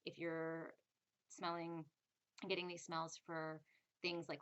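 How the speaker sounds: ordinary talk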